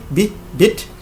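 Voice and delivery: bit with a short, lax i; short i sound. Shown as a demonstration. Teacher showing French-speaking learners the short vowel, short i. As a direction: say "bit" with a brief i as in sit. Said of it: "a le son I".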